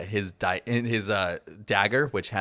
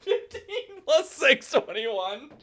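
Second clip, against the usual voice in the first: much higher, giggly slow number reading; laughing while counting; slower than usual. Real, not acted. Player to the game master, laughing while reading rolls. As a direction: squeak out the dice totals through laughter.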